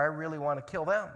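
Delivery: normal talking voice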